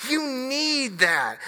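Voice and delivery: ordinary talk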